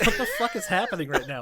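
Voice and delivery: incredulous quote through laughter; laughing disbelief. Retold or imitated. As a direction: laugh out a stunned question. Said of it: "I'm like".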